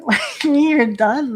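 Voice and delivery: real laugh breaking into a mock-incredulous question; laughing disbelief. Real, not acted. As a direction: ask it incredulously while laughing. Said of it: "I'm laughing 'cause it's like, it's just so ridiculous".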